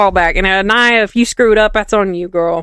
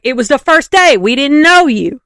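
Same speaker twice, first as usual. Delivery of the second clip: higher, louder exasperated 'we didn't know you'; mock indignant protest; 6 semitones higher, 5 dB louder than usual. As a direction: throw it back at him, hammed-up outrage. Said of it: "And everybody's like".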